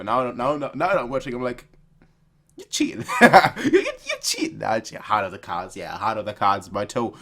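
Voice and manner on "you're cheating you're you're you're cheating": high, giggling accusation; laughing accusation. Real, not acted. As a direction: accuse them while cracking up.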